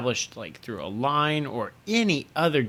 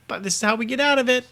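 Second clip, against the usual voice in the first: mock writer excuse; 12 semitones higher, 4 dB louder than usual.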